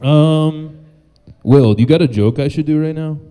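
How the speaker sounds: ordinary talk, nothing marked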